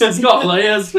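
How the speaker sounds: high, loud echo of an ogre impression; Shrek. Co-host joining in the ogre bit, loud and high. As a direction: throw the ogre catchphrase back, loud. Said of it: "And then Shrek's just like".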